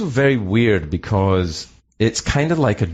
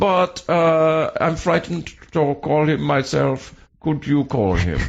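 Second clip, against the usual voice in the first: slow mock German accent; halting, drawn-out German-accented impersonation; 3 semitones higher, slower than usual. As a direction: make the request in a comic German accent. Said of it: "the German thing".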